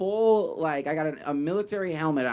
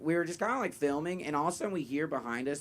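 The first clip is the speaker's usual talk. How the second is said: normal talking voice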